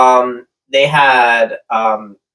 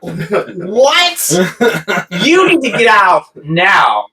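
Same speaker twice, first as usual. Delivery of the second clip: higher-pitched mock police order; incredulous cop; 6 semitones higher, much slower than usual. Retelling the traffic stop, incredulous mock cop. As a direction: order them out as a startled cop.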